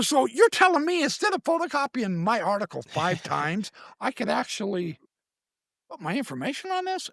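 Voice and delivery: high-pitched, incredulous checking; retold amazement. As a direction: act out the idea dawning on you. Retells his amazed realisation.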